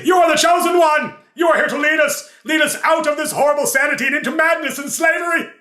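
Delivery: frenzied high zealot voice; insane Darrow. Retold or imitated. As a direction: proclaim it like a crazed dwarf prophet. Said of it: "I played the insane Darrow".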